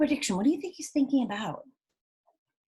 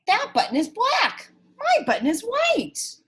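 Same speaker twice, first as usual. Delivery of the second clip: fussy Toad; 11 semitones higher, 4 dB louder than usual. Acted. Toad rejecting the wrong button.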